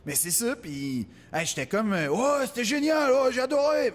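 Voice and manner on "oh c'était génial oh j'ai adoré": higher, louder mock gushing; gushing spectator. Audience praise imitated again.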